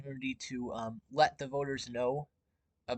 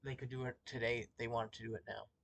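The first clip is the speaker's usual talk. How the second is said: speaking softly away from the mic; quiet; 2 semitones lower, 9 dB quieter, faster than usual. Real, not acted. Muted, talking to mom off-mic.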